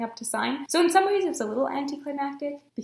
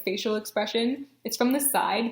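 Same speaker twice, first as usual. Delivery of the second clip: ordinary talk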